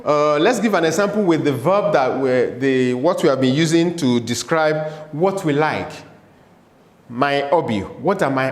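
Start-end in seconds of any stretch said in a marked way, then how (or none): none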